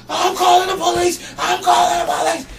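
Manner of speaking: high-pitched mock scream; hysterical threat. Punched bum threatening the worker, hysterical shout. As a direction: shout it as a hammed-up outraged victim.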